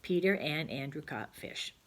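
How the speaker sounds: slow, deliberate dictation; slow model sentence. Shown as a demonstration. Teacher modelling the joined sentence slowly. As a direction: spell it out slowly for the children. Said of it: "And then you could say".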